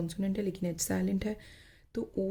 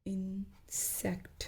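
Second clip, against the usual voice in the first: slow demo word; 6 dB quieter, much slower than usual. Shown as a demonstration. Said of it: "ऐसे ही हम अगर कह रहे हैं".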